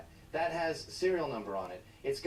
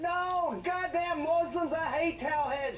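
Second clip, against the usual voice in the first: put-on high, loud whiny kid voices; mocking bigoted kids; 11 semitones higher, 4 dB louder, slower than usual. Kids reacting to the clock, high mock whine. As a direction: parody the kids shrieking. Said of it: "And all the kids went".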